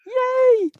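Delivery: high, bright little yay of delight; excited cheer. Real, not acted. Host cheers the start. (hoog en opgetogen juichen)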